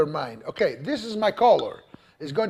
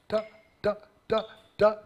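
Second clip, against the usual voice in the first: "ta, ta" more separated and detached; staccato; 2 semitones higher, faster than usual. Shown as a demonstration. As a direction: space out each "ta" sharply. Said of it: "a little bit more separate".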